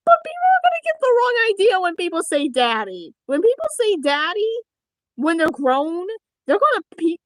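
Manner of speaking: high, loud worried rush; agitated high-pitched fretting; flustered protest. Pretend. Panicked explaining of misread word.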